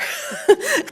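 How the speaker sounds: embarrassed laugh. Real, not acted. Laughs at own retainer slip.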